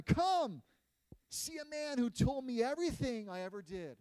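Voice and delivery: loud call to the town. Acted. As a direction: call the whole town to come and see. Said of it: "says to the people".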